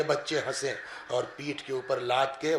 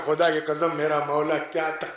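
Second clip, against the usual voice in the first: normal talking voice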